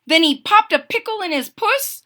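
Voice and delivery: high, lively kitten voice; excited kitty voice. Acted. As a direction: play the excited little cat. Cat character voice.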